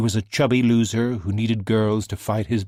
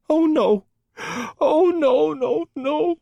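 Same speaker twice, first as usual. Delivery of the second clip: high, crying wail of grief; tearful disbelieving cry; distraught; 4 dB louder, slower than usual. A narrator's reading. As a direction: break down over the news. Neighbour learns Deacon is dead.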